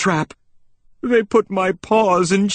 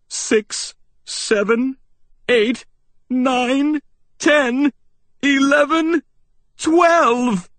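counting the strokes, rising higher; building count; 6 semitones higher, slower than usual. Acted. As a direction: build up the chimes one by one. Clock striking, counting builds.

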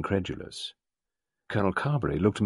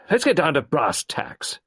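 high, loud, fast clipped colonel; Carbery demanding action, high loud colonel; gruff colonel; 9 semitones higher, 5 dB louder than usual. A narrator's reading. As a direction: bark it like an impatient old soldier. Carbery voiced.

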